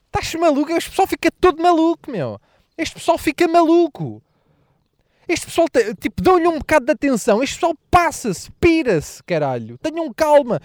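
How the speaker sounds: high-pitched exasperated complaint; exasperated rant. Acted. Comedian complaining about social media people, high and fed up.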